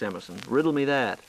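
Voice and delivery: ordinary talk, nothing marked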